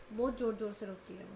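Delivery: plain reading voice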